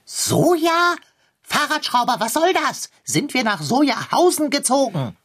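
cat aghast at the soy milk; outraged disbelief. Acted. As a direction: shriek in horrified disbelief.

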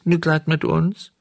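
high whining echo; wailing cat. A narrator's reading. Cat echoes the fox's plea, wailing. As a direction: parrot the plea pitifully. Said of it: "So heulten die zwei Räuber".

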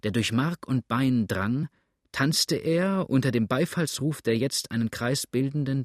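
usual speaking voice

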